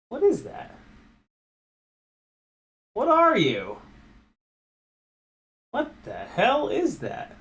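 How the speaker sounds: high, startled questions; startled disgust. Real, not acted. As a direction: recoil at something gross. Finds debris in motor.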